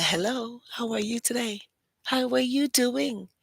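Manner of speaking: stretched, high-pitched mock politeness; exaggerated greeting. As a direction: greet gawkers in a pointedly sweet high voice. Greeting gawking fans at signing.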